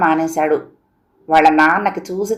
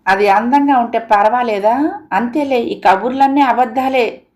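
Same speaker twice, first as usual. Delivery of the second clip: sulky pout; 5 semitones higher, 7 dB louder than usual. A narrator's reading. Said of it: "మొహం ముడుచుకుంది సీత".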